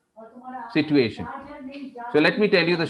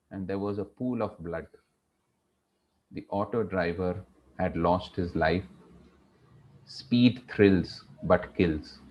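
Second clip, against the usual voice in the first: low, hushed telling with long pauses; slow and sensitive; 6 semitones lower, 4 dB quieter than usual. Shown as a demonstration. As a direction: let the tragedy sink in, softly and slowly. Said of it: "I'm gonna go slow".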